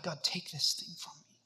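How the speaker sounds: whispered plea; pleading. Acted. Struggling believer begs God to remove sin, pleading in a whisper. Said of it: "And we plead with God".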